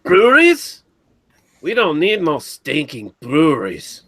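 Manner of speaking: put-on high-pitched movie-bandit Mexican accent, slow on the retort; mock Mexican accent. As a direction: scoff in a hammed-up Mexican accent. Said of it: "do it with a Mexican accent".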